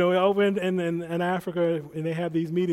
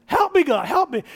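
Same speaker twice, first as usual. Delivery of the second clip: panicked prayer; 8 semitones higher, 6 dB louder than usual. Retold or imitated. Panicked prayer before healing.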